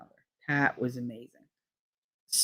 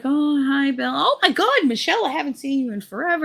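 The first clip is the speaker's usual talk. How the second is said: high, fast mock-delighted chatter; gushing surprised greeting; 10 semitones higher than usual. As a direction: imitate mourners exclaiming at an old acquaintance. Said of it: "And they're like".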